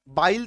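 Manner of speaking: बाइल with an "eye" vowel; wrong vowel. Shown as a demonstration. Teacher showing how not to read it, बाइल with "eye". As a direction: misread bill as बाइल, like "bile". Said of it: "तो नहीं पढ़ रहे".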